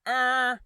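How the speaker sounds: a sudden high, loud "er"; mock his awkward stalled noise; a loud high blurted "er" like a stuck newsman; imitating Wallace's grunt. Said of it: "Then Chris Wallace says".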